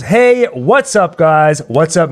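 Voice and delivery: ordinary talk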